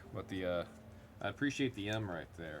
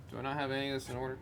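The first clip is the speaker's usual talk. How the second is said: ordinary talk, nothing marked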